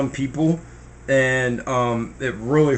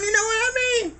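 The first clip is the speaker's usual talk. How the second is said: ordinary talk, nothing marked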